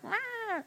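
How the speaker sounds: soft, high-pitched roar; mock yell. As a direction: imitate yelling at a much reduced volume. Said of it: "and I'm yell".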